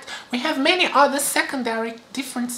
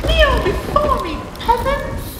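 high, loud royal command; haughty queen; 12 semitones higher, 4 dB louder, slower than usual. Pretend. Claims to be biological queen.

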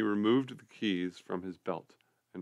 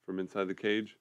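ordinary delivery

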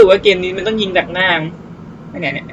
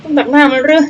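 higher, louder exclamation of disbelief; frustrated shout; 6 semitones higher, 6 dB louder than usual. Real, not acted. Gamer shouting at his missed shots, exasperated. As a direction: cry out why it won't hit. Shots keep missing target.

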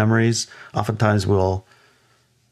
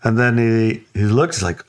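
usual speaking voice

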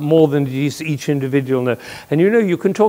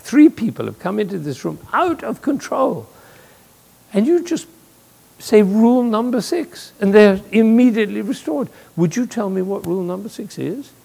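higher-pitched, mildly astonished questioning; acted incredulity; 7 semitones higher than usual. Visiting prime minister to his colleague about rule number six, mock-incredulous. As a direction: play a baffled guest asking for an explanation.

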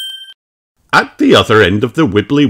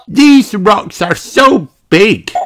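put-on high, slow, louder child voice full of wonder; gasped awe; 13 semitones higher, 6 dB louder, much slower than usual. Peppa amazed by giant rocks, high slow awe. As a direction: Peppa marvels breathlessly. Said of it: "gasped Peppa".

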